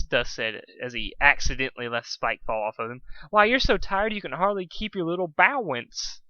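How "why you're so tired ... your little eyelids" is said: mock baby talk. Dust voicing mock baby talk.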